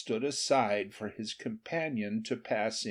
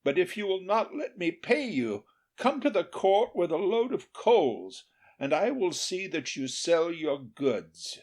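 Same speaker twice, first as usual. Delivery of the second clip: raised, generous king's voice; king's offer; 7 semitones higher than usual. A narrator's reading. King invites the charcoal burner to court. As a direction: invite him warmly to court.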